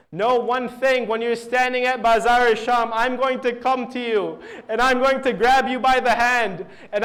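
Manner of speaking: loud, lamenting climax of a sermon; impassioned preaching. A narrator's reading. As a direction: cry out the tragedy to the congregation.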